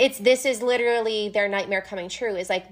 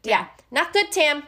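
high, wincing aside; cringing; 5 semitones higher, slower than usual. Retold or imitated. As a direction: wince as you say it. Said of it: "Lucien cringes".

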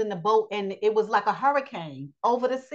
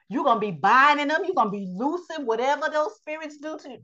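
ordinary delivery

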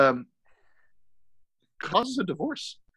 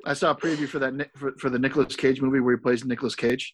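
usual speaking voice

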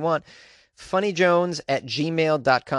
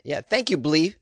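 ordinary talk, nothing marked

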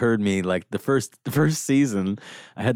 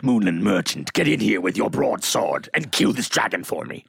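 lightly hammed-up swashbuckler; theatrical command. Summoning a merchant to slay a dragon, mock-grand. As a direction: declaim it like a stage adventurer.

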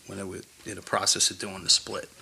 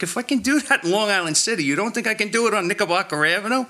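incredulous retort; 10 semitones higher, 4 dB louder than usual. Retold or imitated. Retold banter with his boss.

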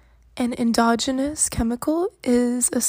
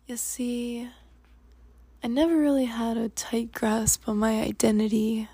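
ordinary talk, nothing marked

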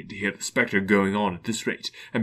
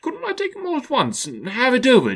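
put-on nervous old Scrooge.

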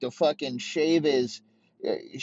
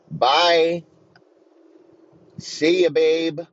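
loud, higher sing-song goodbye; mock farewell; 4 semitones higher, 6 dB louder, much slower than usual. Mocking the wife leaving the coach, loud taunting goodbye.

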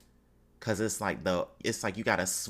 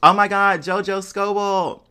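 excited greeting; 8 semitones higher, 10 dB louder than usual. Pretend. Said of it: "I'm about to be like".

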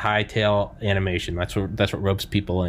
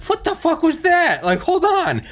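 high, slow stunned disbelief; shocked disbelief. Retold or imitated. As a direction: react like you saw a ghost. Recalled shocked reaction.

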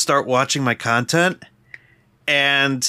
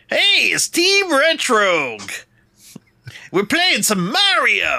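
much higher, slow, grating host voice; Mad Dog Russo style; 9 semitones higher, slower than usual. Retold or imitated. Trying his own intro in the grating radio voice, high and slow. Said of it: "maybe I'll just start to sound like that".

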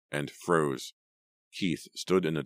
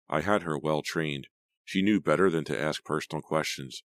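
usual speaking voice

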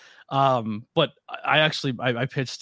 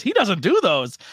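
higher, louder quoted voice; mock disbelief, pitched up; retold friend's reply; 8 semitones higher, 5 dB louder than usual. Bougie doubting the host's guests, voiced higher. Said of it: "He goes".

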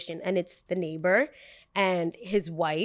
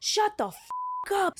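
high, strained yell from afar; mock a yell heard from afar; shouted from downstairs; 12 semitones higher than usual. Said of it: "I hear".

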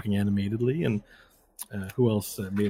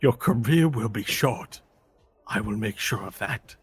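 Mind Master. Acted. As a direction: deliver a villain's vow with measured weight. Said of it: "with anger".

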